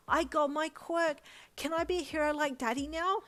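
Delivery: put-on high, loud, thrilled little boy; bursting with joy, bouncing; excited child. A narrator's reading. Boy bouncing up and down over his quirk, thrilled. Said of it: "he asked excitedly".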